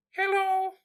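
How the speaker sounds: tiny high-pitched creature; little voice. Acted. Tiny mushroom greeting people through headphones, little high voice. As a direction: squeak it very high, like something tiny. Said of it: "we hear this little voice go".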